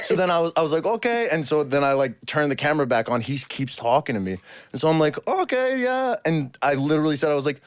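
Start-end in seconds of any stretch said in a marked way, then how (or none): 0.9-1.3 put-on high, awkward German-accented okay. Stuck with a stranger who won't leave, German accent. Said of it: "keep this charade on". 5.3-6.1 put-on high, awkward German-accented assent. Stranger keeps talking to him, high German accent. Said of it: "keep this charade on".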